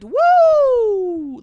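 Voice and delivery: excited cheer. Real, not acted. Owner cheering her shipped order. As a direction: cheer with delight.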